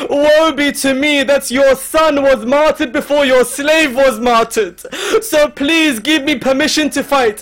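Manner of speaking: high, loud pleading lament; impassioned preaching. Acted. Preacher voicing Abbas begging Hussein to fight, impassioned. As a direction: plead at full voice, mourning as you tell it.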